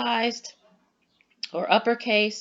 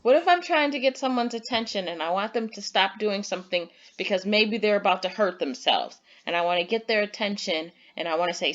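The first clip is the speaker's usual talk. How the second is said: ordinary delivery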